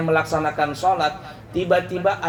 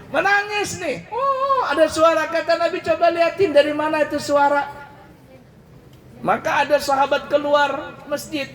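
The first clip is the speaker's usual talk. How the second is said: excited retelling; 10 semitones higher than usual. Acted. Companions report the crying palm.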